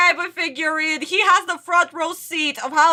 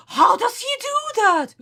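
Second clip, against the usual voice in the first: mock amazement; 7 semitones higher than usual. Mimics the characters' sudden amazement.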